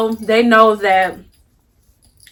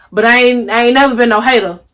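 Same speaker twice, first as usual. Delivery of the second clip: ordinary talk, nothing marked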